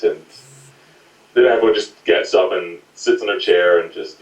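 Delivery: ordinary talk, nothing marked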